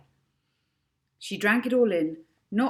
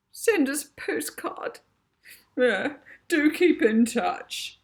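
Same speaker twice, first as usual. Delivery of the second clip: 1 tearful matron voice; 9 semitones higher, 6 dB louder, slower than usual. A narrator's reading. Character voice.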